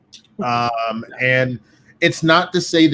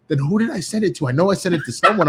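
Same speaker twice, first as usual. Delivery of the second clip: higher, fast, flustered comeback; imitated baffled protest; 5 semitones higher, faster than usual. Colleague insisting he sent the theme, imitated baffled protest. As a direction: play his confused insistence, quick and high. Retold argument over a sent file.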